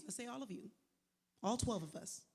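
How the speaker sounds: ordinary talk, nothing marked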